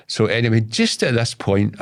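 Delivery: ordinary talk, nothing marked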